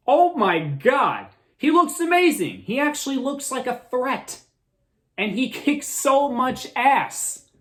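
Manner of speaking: high, thrilled gushing; excited. Real, not acted. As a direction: rave about him. Gushing about Steppenwolf.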